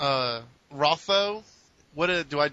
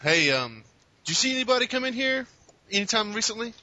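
calling down; 9 semitones higher than usual. Acted. PC hails strangers from balcony.

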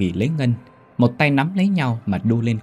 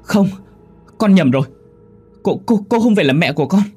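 higher, louder, stammering in fear; frightened denial; 9 semitones higher, 5 dB louder than usual. A narrator's reading. Woman pushing the ghost child away in panic.

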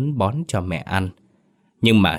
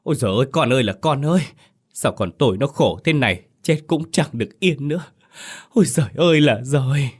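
high keening mother's wail; wailing lament; 7 semitones higher than usual. A narrator's reading. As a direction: cry out in grief for her dead son. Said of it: "Bà rú lên".

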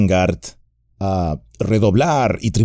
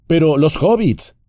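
interrupting; 10 semitones higher than usual. A narrator's reading. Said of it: "interrumpió Legolas".

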